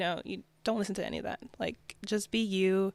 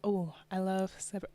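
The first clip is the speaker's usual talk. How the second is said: ordinary delivery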